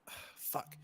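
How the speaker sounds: retold as a soft, voiceless groan; dejected sigh. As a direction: let the curse out under the breath, defeated. Retells dismay at friend being caught.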